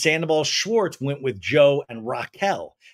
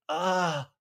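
mock feeble excuse; 6 dB quieter than usual. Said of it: "it looks just like".

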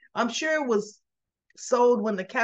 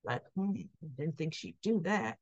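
muttered low and much softer, a private aside; under his breath; 5 semitones lower, 10 dB quieter than usual. Retold or imitated. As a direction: say it to yourself so the listener barely catches it. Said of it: "under his breath".

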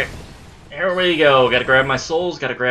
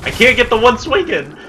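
high, loud exasperated yell; frustrated shout; 11 semitones higher, 8 dB louder than usual. Real, not acted. Player yells, frustrated at missing a swing.

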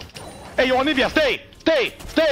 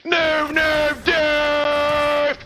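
drawn-out, higher-pitched shout; loud shout; 3 semitones higher, much slower than usual. Real, not acted. Streamer crying out over a death in the game, loud shout. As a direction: yell it, stretching each word. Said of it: "Voice too loud".

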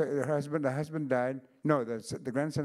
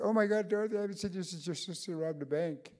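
excited blurt; 8 semitones higher than usual. Retold or imitated. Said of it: "and I said".

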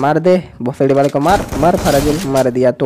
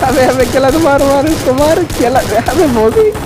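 frantic urging; 10 semitones higher than usual. Real, not acted. Gamer urging teammate Kailash to finish the enemy, high frantic cries. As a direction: egg your teammate on in a frantic rush.

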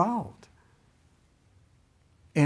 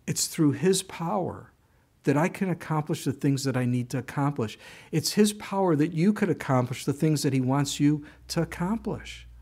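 ordinary talk, nothing marked

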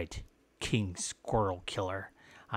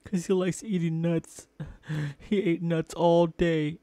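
high, loud mock sobbing whine; hammed-up grief; 7 semitones higher, 6 dB louder than usual. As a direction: wail over the dead squirrel like a cartoon. Mock grief over lost pet squirrel.

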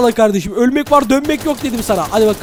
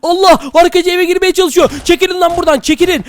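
raised, high-pitched alarm; alarmed shouting; 6 semitones higher than usual. Acted. Player yelling at zombies entering his house, alarmed shout. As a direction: order intruders away in a high, urgent voice.

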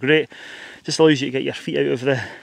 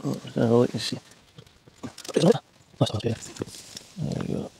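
whispering; 3 semitones lower, 8 dB quieter than usual. Real, not acted. Whispering while unzipping tent.

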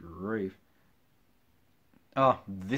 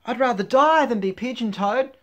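put-on high, loud baby-bird protest; hammed-up squealed refusal; crying baby pigeon; 13 semitones higher, 14 dB louder than usual. A narrator's reading. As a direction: cry out dramatically as a little chick. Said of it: "cried the baby pigeon".

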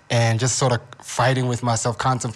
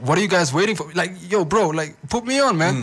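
eager demand; 5 semitones higher than usual. Retold or imitated. Hyped up asking for more drug.

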